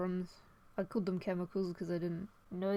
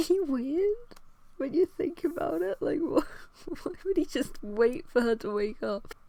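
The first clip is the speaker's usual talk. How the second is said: laughing; 8 semitones higher, 4 dB louder than usual. Real, not acted. Laughing at own story.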